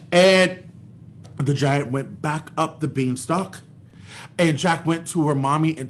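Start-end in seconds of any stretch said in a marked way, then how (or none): none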